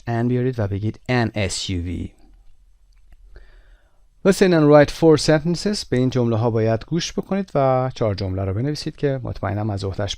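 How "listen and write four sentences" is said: higher, louder, very slow reading of the task; textbook instruction read. Acted. Teacher reads the exercise heading aloud to the class. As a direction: read the heading like a course audio.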